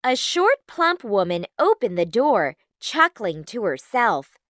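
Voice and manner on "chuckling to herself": ordinary delivery, nothing marked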